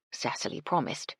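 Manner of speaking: ordinary delivery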